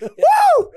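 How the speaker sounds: very high, loud whoop; a whooping gym cheer; hype whoop. Retold or imitated. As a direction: let out a wild gym whoop. Hyping up in the gym.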